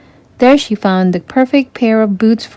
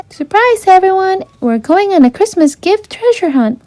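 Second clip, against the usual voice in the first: much higher, bubbly voice; excited announcement; 10 semitones higher than usual. A narrator's reading. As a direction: announce a game with glee. Ariel announces treasure hunt.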